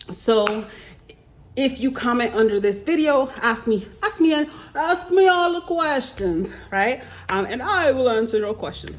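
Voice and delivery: hammed-up Jamaican psychic voice for the viewers; Miss Cleo accent. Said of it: "I don't know what accent I'm doing".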